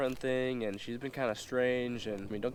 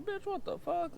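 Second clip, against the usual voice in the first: way higher, disbelieving squeal; incredulous shriek; slower than usual. Retold or imitated. Reacting to her message, high incredulous squeal. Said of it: "And I was like".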